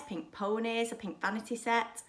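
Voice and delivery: plain reading voice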